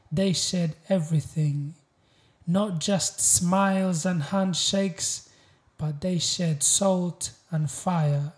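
proud, weighty spoken-word delivery; spoken-word poem. Acted. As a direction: declaim each line with deliberate pauses. Recites a poem.

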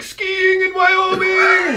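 high, long-held comic cry; high-pitched slow holler; drawn-out mock yell. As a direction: stretch it out way up high. Mock reaction to a nuclear blast.

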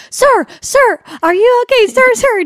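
high, loud, robotic first-aid script voice; mock-official rescuer calling out to a man; training-video first aider. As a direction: call out like a CPR training video. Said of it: "do exactly like the training video".

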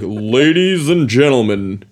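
booming game-show host announcer. Shown as a demonstration. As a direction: announce it to a big crowd. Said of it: "like an announcer voice".

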